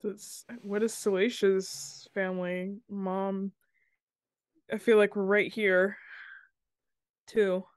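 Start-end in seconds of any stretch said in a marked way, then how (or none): none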